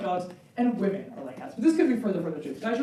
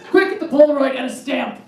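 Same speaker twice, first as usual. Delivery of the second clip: excited mom; 4 semitones higher, 9 dB louder than usual. Retold or imitated. Mom rushing to photograph it.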